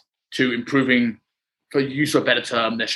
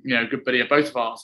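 usual speaking voice

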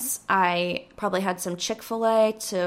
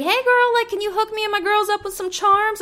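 fake friendly girl; 11 semitones higher than usual. Thief greeting the clerk with a high, sugary, fake friendly voice. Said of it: "and she's like".

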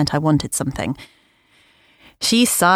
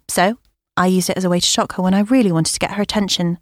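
ordinary delivery

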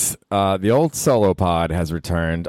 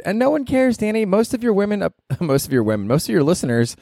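lightly voiced higher partner, mildly exasperated; scolding partner; 12 semitones higher than usual. Partner cutting off his baseball talk, in a higher mock-exasperated voice. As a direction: tease him like a fed-up girlfriend.

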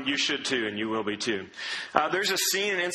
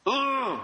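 sharp high groan of annoyance; mock frustrated groan; exasperated ugh; 9 semitones higher than usual. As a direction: let out a fed-up ugh.

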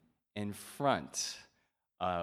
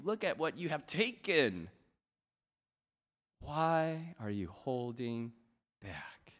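God's earnest appeal; slower than usual. Acted. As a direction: plead patiently as God to his people. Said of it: "how God looks at us".

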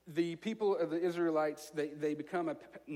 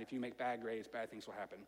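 much lower and softer, ominous; dark, dropped voice; 8 semitones lower, 10 dB quieter than usual. Shown as a demonstration. Preacher warns the congregation about bad grades, low and ominous. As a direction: let it sink low and grim. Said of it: "good versus bad contrast".

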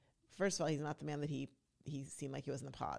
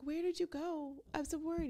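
mock worried girlfriend; 12 semitones higher than usual. Vlogger imitating Chelsea greeting him back, high and fretful.

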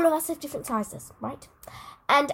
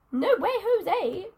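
slow, teasing no way Jose; mock drawn-out refusal; 4 semitones higher, 4 dB quieter, much slower than usual. Real, not acted. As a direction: drag it out, playfully refusing. Playful dismissive catchphrase.

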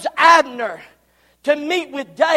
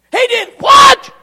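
very high shouted outrage, rising; even louder disbelief; 9 semitones higher, 10 dB louder than usual. Acted. Joab repeating the news, shrieking outrage. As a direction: explode in disbelief.

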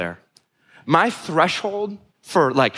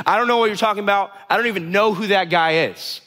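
quick, higher-pitched acted denial; panicked denial; 6 semitones higher than usual. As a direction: blurt it out in mock panic. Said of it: "Peter just panics and says".

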